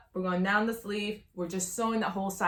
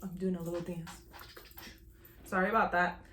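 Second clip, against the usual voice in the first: usual speaking voice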